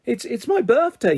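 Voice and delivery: high, halting acted tearfulness; mock sobbing. A narrator's reading. Sam protests to his family, mildly acted sobbing. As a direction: stammer it as an upset child. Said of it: "sobbing now properly".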